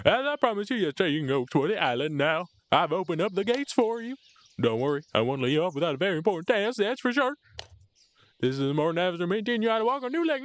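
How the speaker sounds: high, bright cartoon NPC voice; cheery game character voice. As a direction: chirp it like a game guide. Reads NPC dialogue in voice.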